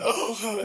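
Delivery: high, breathless oh; imitating a man pinned and gasping; mock winded gasp. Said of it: "he's gasping for breath".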